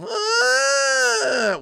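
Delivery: high, drawn-out cackle; long hammed-up gloating laugh; mock villain cackle. Streamer hamming up reaction to chat, high mock cackle.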